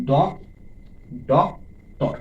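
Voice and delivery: teacher separating ডক from টর; syllable by syllable. Shown as a demonstration. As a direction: restart on "ডক", then ডক, pause, টর. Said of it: "দুইটি syllable আছে".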